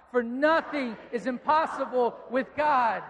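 shouted slowly, full conviction; loud proclamation. Shown as a demonstration. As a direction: proclaim it loud and slow to the whole room. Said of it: "Would you say it loud?".

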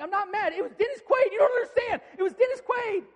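high-pitched, loud, frantic protest; yelling. Retold or imitated. Husband retells arguing with his wife on the phone, frantic shouting. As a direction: shout a defensive denial, voice way up. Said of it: "why are you yelling at me?".

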